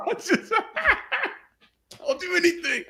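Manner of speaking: mock pleading, cracking up; laughing imitation of a desperate player. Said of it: "said he's willing to do anything".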